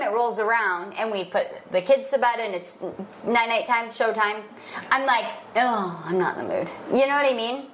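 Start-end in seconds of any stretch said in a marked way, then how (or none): 5.6-6.7 low, deflated imitation of losing interest; half-acted low groan of reluctance; mock reluctance. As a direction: drop the pitch, acting unwilling. Acts out her later reluctance.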